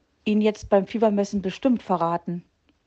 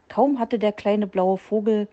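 ordinary delivery

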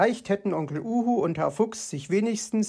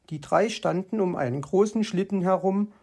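ordinary delivery

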